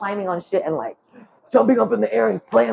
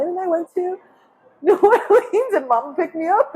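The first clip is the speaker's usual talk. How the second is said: laughing; 10 semitones higher than usual. Real, not acted. Laughing while telling story.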